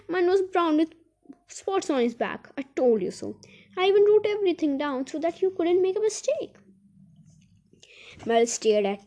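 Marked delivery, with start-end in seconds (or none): 0.1-6.5 high, insistent telling-off; exasperated complaint, pitched up; indignant scolding. A narrator's reading. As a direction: scold for a stupid mix-up. Dame Grumble scolds over the mix-up.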